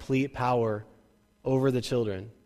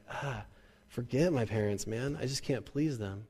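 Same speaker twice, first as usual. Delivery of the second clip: soft, defeated sigh of resignation; crushed in spirit; 7 dB quieter than usual. Acted. Discouraged kid giving up on parents, crushed and quiet. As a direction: give up quietly, heart lost. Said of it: "crushed in spirit".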